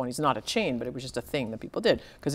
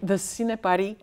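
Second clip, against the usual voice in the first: name said in a Greek accent; 4 semitones higher than usual. Shown as a demonstration. Author showing how you have to say it, Greek accent. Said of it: "saying it with a Greek accent".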